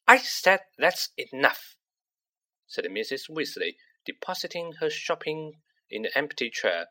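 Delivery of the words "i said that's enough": slow, louder, insistent; sterner warning. A narrator's reading. Mrs Weasley repeating herself to the twins, sterner. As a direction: lay down the law the second time.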